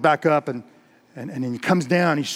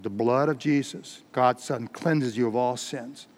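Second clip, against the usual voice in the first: lower, slow, steady assurance; calm preacher holding his ground; unflinching calm; 4 semitones lower, 7 dB quieter than usual. Acted. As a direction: answer without flinching, unhurried and restrained. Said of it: "Finney didn't flinch".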